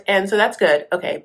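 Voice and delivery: ordinary talk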